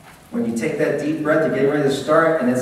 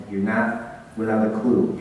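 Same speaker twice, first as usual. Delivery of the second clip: ordinary talk, nothing marked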